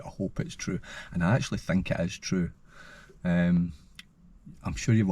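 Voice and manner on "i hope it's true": breathy whisper of hope. Real, not acted. Vlogger whispering his hope about the Irn-Bru story. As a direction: whisper it like a secret wish. Hushed hopeful aside to camera.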